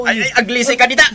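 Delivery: hurried urgent. Acted. Husband shoos wife, laughing.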